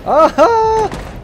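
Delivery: sudden high yelp of surprise; startled cry, way up high; surprised yelp. Real, not acted. Teammate peeks, sudden yelp.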